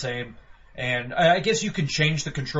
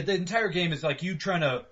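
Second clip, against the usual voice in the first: ordinary delivery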